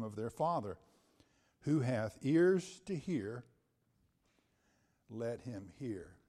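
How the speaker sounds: measured, emphatic Bible reading with pauses between phrases; solemn pulpit reading; scripture reading. Acted. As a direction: proclaim the verse deliberately, phrase by phrase. Reads scripture aloud to congregation.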